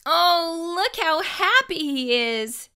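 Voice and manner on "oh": ordinary delivery, nothing marked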